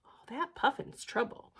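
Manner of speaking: plain reading voice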